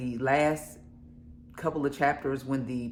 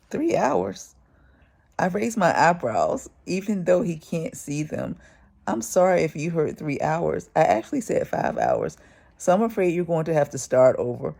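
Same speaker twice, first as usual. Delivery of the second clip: reading a novel aloud, louder and animated; story narration; 2 semitones higher, 6 dB louder than usual. A narrator's reading. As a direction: read the passage like an audiobook. Reads book aloud.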